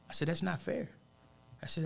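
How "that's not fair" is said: higher, quiet objection; mild wounded disbelief; retold hurt protest. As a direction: protest softly, pitched up. Retold protest at death news.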